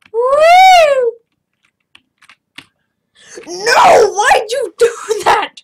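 high, loud crying yell; anguished shout. Real, not acted. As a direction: wail in despair. Launched, son lost.

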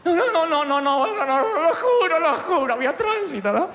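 high, loud, pleading, half laughing; panicked excuses. Retold or imitated. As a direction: beg the boss to believe you. Excuse to the boss. (excusas agudas, fuertes y desesperadas)

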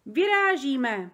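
loud, drawn-out, slow rallying call. A narrator's reading. Giant announces departure to Sofie, calling out. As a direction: call it out, stretching the word. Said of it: "zvolal Obrdobr".

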